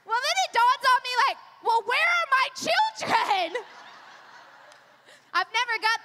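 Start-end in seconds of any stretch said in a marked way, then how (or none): 1.6-3.6 high, drawn-out comic panic; hammed-up motherly outcry, slow and pitched up; mock alarm. Mom suddenly asking the babysitter about her kids, mock panic. Mom realizes, acted for comedy.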